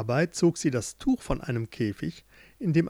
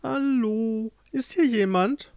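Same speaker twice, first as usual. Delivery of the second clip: higher-pitched searching call; 8 semitones higher, slower than usual. Acted. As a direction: call out for someone unseen. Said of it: "rief er nach ihr". (suchend rufen, mit hoher Stimme)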